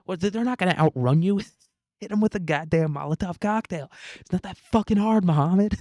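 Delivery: high-pitched exasperated mock yelling; yelling handler. CIA handler berating Mohammed for botching the attack, mock exasperated yelling. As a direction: act out an incredulous, fed-up handler chewing someone out. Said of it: "his handler's yelling at him".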